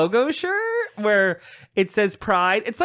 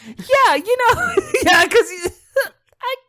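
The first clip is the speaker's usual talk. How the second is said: squealing with laughter, voice way up; high-pitched laugh; 5 dB louder than usual. Real, not acted.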